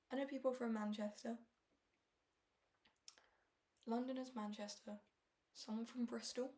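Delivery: normal talking voice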